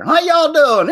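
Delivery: loud, high Southern holler; over-the-top high-pitched Southern whoop; exaggerated Southern drawl. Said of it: "I just had a sudden drawl there".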